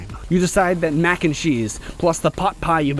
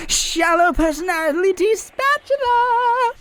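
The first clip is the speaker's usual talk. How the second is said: dramatic move call; slower than usual. Acted.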